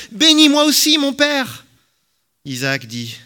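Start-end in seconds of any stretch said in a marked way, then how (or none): 0.1-1.7 high, loud, anguished cry; bitter cries. A narrator's reading. Esau begs his father for a blessing, crying out bitterly. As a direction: wail it out, full of bitterness. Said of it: "il poussa de grands cris, plein d'amertume".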